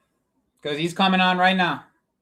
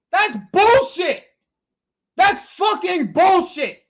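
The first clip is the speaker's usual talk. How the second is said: slow angry rant; 9 semitones higher, 3 dB louder, slower than usual. Real, not acted. Angry reaction to match event.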